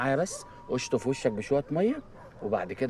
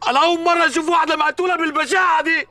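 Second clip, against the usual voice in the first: shocked; 12 semitones higher, 6 dB louder than usual. Acted. Shocked cop exclaims.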